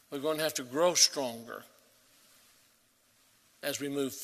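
normal talking voice

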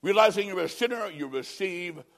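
ordinary delivery

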